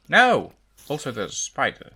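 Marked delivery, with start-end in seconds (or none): 0.1-0.5 sharp high loud no; shouting no. Real, not acted. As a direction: yell it out in alarm. Reacting to spider.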